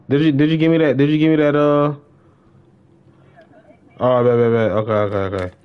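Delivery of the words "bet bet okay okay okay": ordinary delivery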